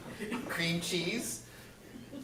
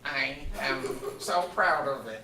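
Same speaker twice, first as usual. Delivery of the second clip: dad voice; 6 semitones lower than usual. Acted. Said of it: "New dad voice".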